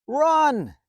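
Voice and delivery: ordinary delivery, nothing marked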